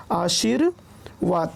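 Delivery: आशीर्वाद slow, pause after आशीर; split pronunciation. Shown as a demonstration. Teacher repeating the reph rule, आशीर्वाद slowly split. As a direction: separate आशीर from वाद in आशीर्वाद. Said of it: "उच्चारण उसके पहले होता है".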